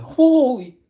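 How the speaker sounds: high, loud stunned exclamation; shocked holy. Retold or imitated. Streamer seeing the wife, loud high shocked cry. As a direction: blurt it out in shock. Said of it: "And then I'm like".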